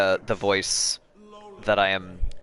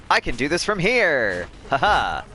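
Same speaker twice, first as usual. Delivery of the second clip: triumphant; 6 semitones higher, 3 dB louder than usual. Real, not acted. Triumph in game.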